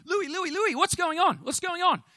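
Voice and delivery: high, loud frantic calling of Louis; alarmed wife. Retold or imitated. Preacher voices the wife running into the bathroom, high and loud alarm. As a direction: burst in like a worried wife.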